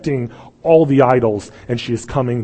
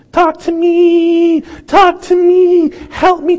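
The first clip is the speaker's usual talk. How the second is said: high, drawn-out yell for attention; hammed-up pleading yell, stretched long; mock desperate shouting; 7 dB louder, much slower than usual. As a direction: holler at someone who's ignoring you. Desperate call to someone ignoring him.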